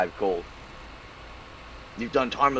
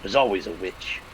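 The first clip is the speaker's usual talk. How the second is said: snarling; 3 semitones lower than usual. Acted. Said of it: "He snarls".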